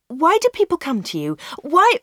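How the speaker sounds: high, urgent demand for an answer; insistent questioning. A narrator's reading. Anna presses Dave with questions.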